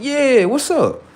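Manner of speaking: high, slow, drawn-out what's up; stretched curious prompt; eager. Retold or imitated. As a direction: lean in impatiently. Waiting for brother's news.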